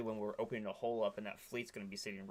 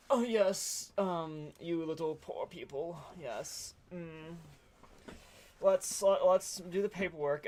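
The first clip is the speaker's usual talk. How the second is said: high, loud, sneering bureaucrat voice; snooty inspector; 7 semitones higher, 6 dB louder, slower than usual. Retold or imitated. Perla inspector condescending to poor refugees, high loud mocking voice. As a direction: talk down to the poor folk in a high, loud put-on voice. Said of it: "very annoying conversation of".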